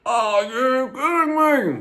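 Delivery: high, loud, slow delighted squeal; excited squeal. Real, not acted. Same phrase squealed in delight.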